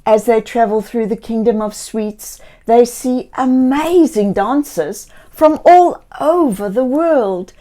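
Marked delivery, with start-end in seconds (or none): none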